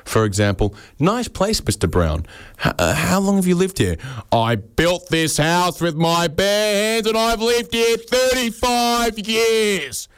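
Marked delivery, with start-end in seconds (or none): 1.0-4.0 high, chirpy small-talk voice; a friendly caller making small talk; high mock polite visitor. Visitor greets house owner. 4.3-10.1 high, slow, drawn-out defensive boast; mock aggressive, stretched-out bluster; defensive homeowner. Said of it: "aggressive and defensive".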